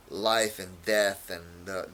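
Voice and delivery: normal talking voice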